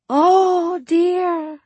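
high, loud fake Suli dismay; overdone fortune-teller sorrow; mock dismay. Feigned dismay in fake accent.

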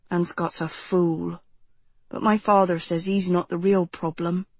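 put-on low, measured teenage boy; grave, understated young man; serious young man. Narrator voices Jonathan in dialogue.

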